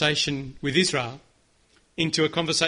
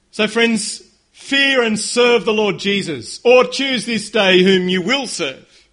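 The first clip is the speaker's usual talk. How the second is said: louder, slow preaching cry; passionate proclamation; 3 semitones higher, 8 dB louder, slower than usual. Acted. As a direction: proclaim it slowly, full voice. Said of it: "I wanna hear some passion".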